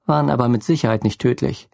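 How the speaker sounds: usual speaking voice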